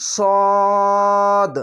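সদ with a long rounded aw, no aa; chant-like, drawn-out sawd; thick letter. Shown as a demonstration. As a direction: hold a heavy সদ on one note. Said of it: "আকার উচ্চারণ হবে না".

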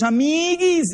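put-on high girlish sigh; mocking the girls' tender reaction; girlish coo. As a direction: say it like a teenage girl to her friend.

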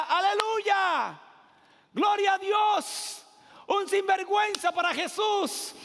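high, loud, drawn-out mock hallelujah; mock churchgoers praising. Pastor mocks the crowd cheering Zacchaeus, loud and slow. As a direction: ham up the crowd's praise, stretched out. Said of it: "la gente en el verso siete decía".

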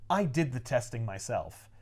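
low, soft, acted reveal; quiet accusation. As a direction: lay out the charge low and quiet. Peter quietly lays out accusation.

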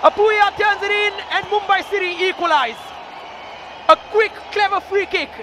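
excited goal call. Real, not acted. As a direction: lift up as it goes in. Equalizing goal called live.

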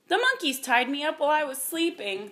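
a hint of a woeful creature; plaintive dragon. A narrator's reading. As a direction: complain a little pitifully. Tearful trapped dragon explains plight.

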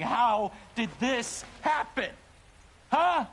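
loud, slow, rising desperate yell; anguished yell. Acted. Grieving father shouting at the cop for answers. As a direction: demand an answer in despair.

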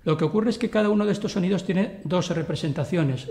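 ordinary delivery

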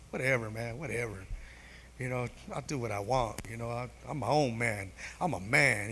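low, quiet macho nonchalance; keeping composure. Acted. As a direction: play it cool, dismissive and under the breath. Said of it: "I gotta keep composure".

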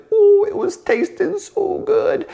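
high, slow, savoring delight. Real, not acted. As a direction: gush over the taste. Recalling the tasty mead.

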